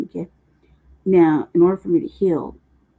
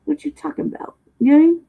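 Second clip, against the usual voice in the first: playful excited; 10 semitones higher, 4 dB louder, faster than usual. Real, not acted. Playful talk to audience.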